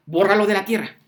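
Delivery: loud, high furious shout; angry mob shout. Retold or imitated. Soldiers and crowd screaming at the commander, loud angry demand. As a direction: roar it at the commander. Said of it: "le empiezan a gritar al comandante".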